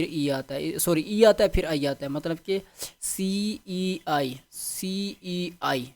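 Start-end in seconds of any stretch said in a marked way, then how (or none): 3.0-5.8 letters spelled slowly, one by one; slow spelling out. Shown as a demonstration. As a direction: drill each letter slowly for students to memorize. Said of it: "اس کا چیز یاد رکھنا". (آہستہ آہستہ ہجے کر کے)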